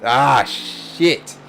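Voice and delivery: ordinary talk, nothing marked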